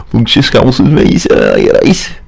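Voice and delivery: hammed-up fake Scouse; botched Scouse accent. Presenter mocks own failed attempt, bad Scouse accent. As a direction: have a poor go at Scouse. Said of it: "trying to do a Scouse accent".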